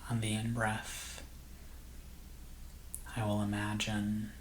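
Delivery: soft, slow meditation voice with long pauses; guided meditation. Acted. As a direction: gently guide listeners into calm, unhurried and hushed.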